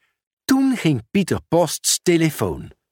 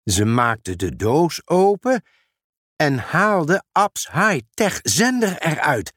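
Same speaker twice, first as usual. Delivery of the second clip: usual speaking voice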